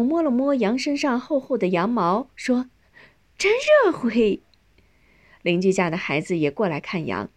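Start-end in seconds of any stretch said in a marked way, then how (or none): 3.4-4.4 high, delighted exclamation. A narrator's reading. Feeling the sheep's thick wool, delighted. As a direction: coo over it in a much higher voice. Child touches the warm wool.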